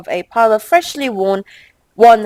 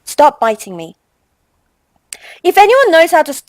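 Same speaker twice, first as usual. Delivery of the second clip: normal talking voice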